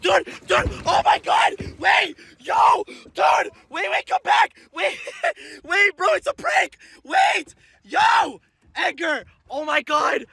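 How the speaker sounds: wild panicked shouts; panicked yelling. Real, not acted. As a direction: yell after the boat in panic. Alligator prank panic.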